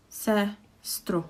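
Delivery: ordinary talk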